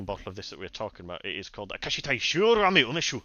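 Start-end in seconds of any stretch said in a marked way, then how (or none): 1.7-3.2 name the sake in a hammed-up Japanese accent; a self-mocking Japanese accent on the brand. Host reads the sake name aloud: mock Japanese accent. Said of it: "my extremely offensive Japanese accent".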